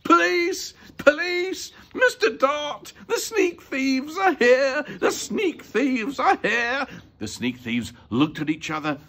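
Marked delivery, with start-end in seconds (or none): 0.0-6.9 high, loud alarm cry; shrieked, urgent call for the police; terrifying loud shout. A narrator's reading. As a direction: yell for help at the top of your voice. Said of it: "he shouted in his loudest, most terrifying voice".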